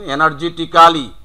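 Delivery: এনার্জেটিক্যালি as "e-nar-je-ti-KA-li"; full "-ka-li" ending on এনার্জেটিক্যালি; local mispronunciation. Shown as a demonstration. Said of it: "not energetically".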